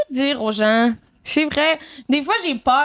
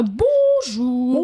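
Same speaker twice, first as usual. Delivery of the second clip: drawn-out mock-grand greeting; imitating theatrical caller. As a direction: mimic a friend's over-the-top phone character. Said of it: "je pense souvent à". (imitation théâtrale, bonjour étiré)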